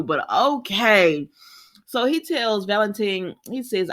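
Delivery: usual speaking voice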